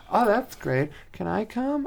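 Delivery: put-on high, sweet girlfriend voice; high-pitched mock girly asking. Voicing girlfriend in a story.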